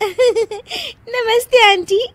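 high, slow greeting bubbling with laughter; giggly greeting. Acted. New bride greeting the aunties, giggling through it. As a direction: greet them while giggling uncontrollably.

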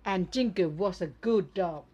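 very slow, loud, each word spelled out; loud and slow, like reading to a toy; slow clear English. Shown as a demonstration. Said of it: "ต้องพูด ภาษาให้ชัดนะคะ".

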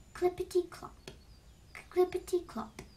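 high, bouncy clippity-clop; trotting hoofbeats. A narrator's reading. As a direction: tap it out like trotting hooves. Brother goat's hoofsteps on bridge.

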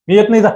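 high-pitched exclamation on "मी येत नाही जा"; exclamatory. Shown as a demonstration. Teacher showing the exclamation in Chintu's shouted line, exclamatory. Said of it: "उद्गारवाचक आहे".

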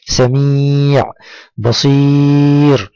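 سميع، بصير with each ī held long and level; long drawn-out vowel. Shown as a demonstration. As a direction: stretch the ي in سميع and بصير on a steady note. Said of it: "أن هنا مد بالياء". (مدّ الياء طويلًا في «سميع، بصير»)

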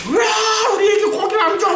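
high, loud mock-protesting shout; demanding complaint. Israelites demanding meat from their leaders, mock angry shout. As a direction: shriek it like an outraged crowd. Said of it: "막 이러고 따지는 사람들".

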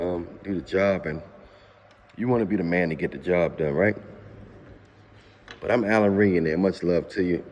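normal talking voice

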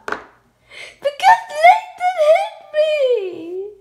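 high, wailing fake sobs; crying. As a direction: wail it like a crying little girl. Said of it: "Why are you crying".

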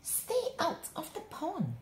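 high, sharp yell. A narrator's reading. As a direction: shout it at the others. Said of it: "yelled Milton".

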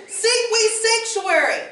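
high, loud, slow desperate plea; near-hysterical pleading. Acted. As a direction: beg like panic is about to win. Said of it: "implored upon the verge of hysteria".

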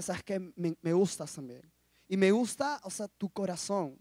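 soft, hesitant admission; shy confession. Retold or imitated. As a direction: confess your feelings quietly. Re-enacts his shy love confession.

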